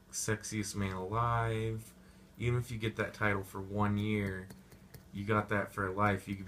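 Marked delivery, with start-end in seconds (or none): none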